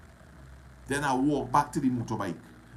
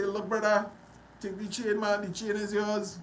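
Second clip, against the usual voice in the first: eager offer; 9 semitones higher than usual. Retold or imitated. Retold dialogue giving chain away.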